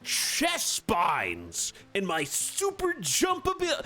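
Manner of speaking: high, loud, excited villain shout; hyped vampire voice. Retold or imitated. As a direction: charge in screaming and then panic. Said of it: "He was like".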